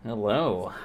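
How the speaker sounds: ordinary talk, nothing marked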